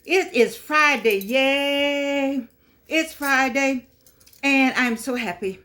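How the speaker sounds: drawn-out, sing-song celebration of Friday; a gleeful slow yay for Friday; happy Friday cheer. Real, not acted. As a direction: cheer the weekend in slowly, savoring each word. Happy Friday greeting to viewers.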